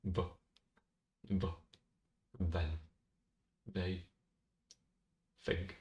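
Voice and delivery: the, the, then, they, think with TH said like a V sound; they said as vay, the TH buzzing like a V. Shown as a demonstration. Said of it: "you just go".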